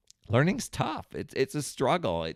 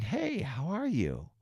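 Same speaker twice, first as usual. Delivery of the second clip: friendly greeting; 5 semitones higher, 6 dB quieter, slower than usual. Acted. Acted phone greeting to friend.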